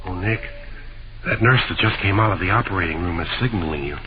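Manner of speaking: low, subdued hospital voice; hushed. Acted. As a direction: keep it down outside the operating room.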